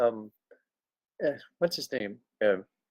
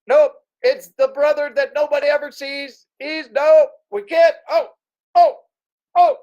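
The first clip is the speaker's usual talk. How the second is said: flustered dad; 8 semitones higher, 3 dB louder than usual. Retold or imitated. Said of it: "Pop was like".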